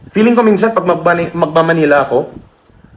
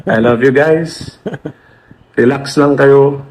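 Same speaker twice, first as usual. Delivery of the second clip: slow, lower, tender reassurance; reassuring; 4 semitones lower, slower than usual. Real, not acted. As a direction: soothe your viewers gently. Calming viewers, slow and low. (malambing at mabagal na pagpapakalma)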